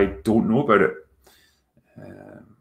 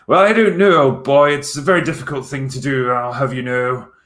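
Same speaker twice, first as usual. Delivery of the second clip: put-on high, loud upper-class English accent; posh English accent; 5 semitones higher, 4 dB louder than usual. For a viewer who asked, a posh English accent. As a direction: play a plummy old English gent. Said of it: "can you do a proper English accent".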